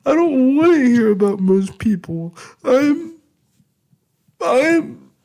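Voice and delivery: very high, loud sobbing, words breaking off; breaking into tears. Acted. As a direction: let the sentence collapse into crying. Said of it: "I begin crying once more".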